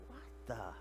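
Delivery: very soft, low, stunned half-question; acted hushed awe; awed disbelief. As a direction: breathe it out dumbstruck. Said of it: "fear is like awe and disbelief".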